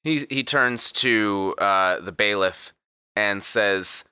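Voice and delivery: usual speaking voice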